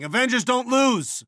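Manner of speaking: high, desperate protest; distressed disbelief. Acted. Watching the Avengers fail, distressed. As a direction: refuse to accept it, pitch way up. Team facing defeat.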